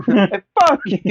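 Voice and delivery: high squeaky fuck you through laughter; [name] hangs up on Lori, laughing; laughing mock curse. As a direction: giggle it out. Voicing with laughter.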